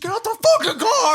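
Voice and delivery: very high mock scream; imitated angry shout. Imitating friend screaming at the cornered driver, very high shout. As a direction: scream it as an enraged order. Acting out George shouting once more.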